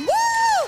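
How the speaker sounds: high, loud praise whoop; joyful whoop. Real, not acted. Preacher shouting praise to the church, joyful whoop. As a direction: whoop for joy.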